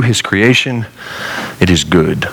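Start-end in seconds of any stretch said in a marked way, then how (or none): 1.6-2.3 a hint of a solemn divine pronouncement; voice of God. God looking upon his creation, lightly voiced. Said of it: "God said in the beginning".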